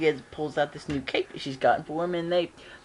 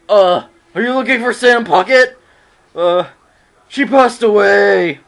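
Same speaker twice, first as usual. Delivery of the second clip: loud, higher put-on official voice as Sam; louder fake brush-off of the agents; Sam's fake voice; 5 semitones higher, 12 dB louder than usual. Sam fooling Secret Service, loud mock voice. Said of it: "I love Sam's impression".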